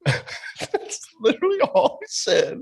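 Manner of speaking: high-pitched, laughing through the words; incredulous laughing talk. Real, not acted. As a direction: crack up while telling it. Laughing while telling it.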